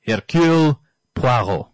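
Hercule Poirot said with a French accent, Poirot as pwaʁo. Shown as a demonstration. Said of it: "let me put on my best French accent, or best French impersonation".

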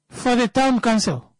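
ordinary delivery

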